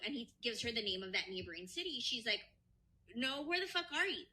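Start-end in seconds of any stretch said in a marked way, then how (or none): 3.2-4.2 acted indignant demand. Retelling wife confronting husband on FaceTime, mock indignant. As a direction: imitate a wife demanding an answer.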